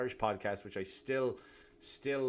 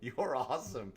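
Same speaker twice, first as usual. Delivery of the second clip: fake praise; 6 semitones higher than usual. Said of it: "and you go".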